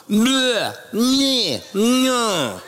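swollen-tongue bleh sounds; tongue-tied babble. Retold or imitated. Pastor suddenly unable to talk, thick tongue-tied babble. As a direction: babble like your tongue swelled. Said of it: "he can't talk".

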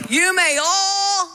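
"all" held long and high; stress on all. Shown as a demonstration. Preacher prompting congregation to finish the verse, "all" stretched. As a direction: draw out the word "all" on a high note.

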